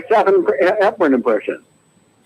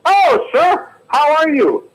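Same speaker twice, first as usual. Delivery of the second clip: soda jerk voice; 12 semitones higher, much slower than usual. Acted. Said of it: "here's my impression of Jimmy Stewart".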